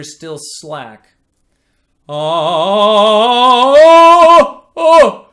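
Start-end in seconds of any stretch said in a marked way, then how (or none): 2.1-5.3 loud, much higher, trapped strained oh; folded-over voice. Shown as a demonstration. Teacher showing students a trapped voice, a loud strained oh. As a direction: let the sound fold over in the throat. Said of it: "it just basically folds over on itself".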